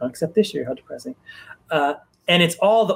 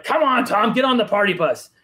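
higher-pitched, beckoning call; 6 semitones higher than usual. Retold or imitated. Party-goers urging him aboard the party bus, calling out. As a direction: call a friend over to join in.

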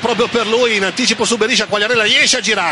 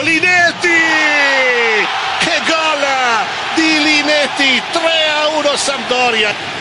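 higher, shouted scorer's name; excited goal shout; 5 semitones higher, slower than usual. Real, not acted. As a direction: hail the scorer, then savour the score. Goal call in commentary.

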